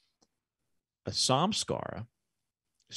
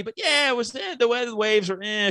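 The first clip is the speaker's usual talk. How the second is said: mock jaded traveler; 12 semitones higher, 3 dB louder than usual. Mocking a bored vacation brag.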